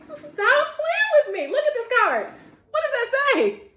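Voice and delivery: high and loud, laughing through the words; laughing disbelief. Real, not acted. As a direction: laugh at the card in delighted disbelief. Laughs at the oracle card.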